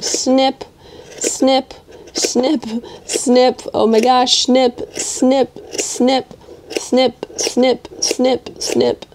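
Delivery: playful snip-by-snip sound effects; little spoken snips in rhythm; scissor soundtrack. Pretend. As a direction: voice each scissor cut, spaced out. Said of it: "giving you, like, a soundtrack".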